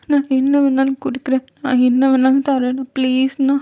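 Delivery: put-on high, tearful girl's desperate pleading; pleading young girl. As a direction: plead for her sick mother in a high, teary voice. Girl promises anything. (அம்மாவுக்காக கெஞ்சும் சிறுமி குரல்)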